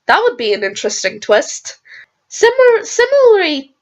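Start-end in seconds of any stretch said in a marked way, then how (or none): 2.3-3.7 voice cracking. Real, not acted. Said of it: "my, my voice is going".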